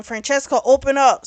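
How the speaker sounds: loud call that climbs on open up; yelling. Retold or imitated. Angry ex shouting at the door for Francesca to open. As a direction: bang the door and shout for her. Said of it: "Xavier's yelling".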